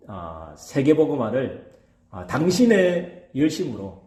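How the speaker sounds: ordinary delivery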